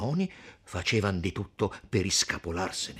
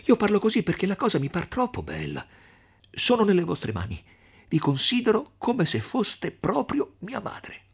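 high, urgent, earnest appeal; impassioned, pitched-up entreaty; placating plea; 12 semitones higher, 5 dB louder than usual. Acted. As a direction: win them back, pleading eagerly. Renzo placates and flatters Agnese.

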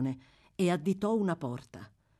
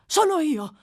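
high, loud outburst; sudden raised-voice reply; startled cry; 12 semitones higher, 8 dB louder than usual. A narrator's reading. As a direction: cry out in answer to your name. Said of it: "gridò l'ebreo".

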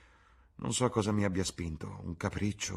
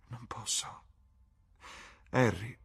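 hushed, breathy sadness; sad reply; 4 semitones higher than usual. A narrator's reading. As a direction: answer sadly, almost without voice. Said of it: "rispose Dorian tristemente". (risposta triste, sommessa)

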